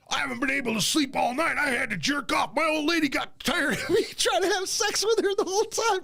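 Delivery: high-pitched shouted mock complaint; imitating livid rant. Friend retells Glenn complaining about a sleepless night, mock livid. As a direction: rant it out as an outraged man, comically exaggerated. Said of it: "he's fucking livid mad at me".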